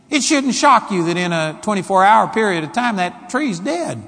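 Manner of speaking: usual speaking voice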